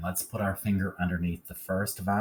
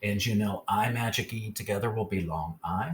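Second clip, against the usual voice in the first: ordinary delivery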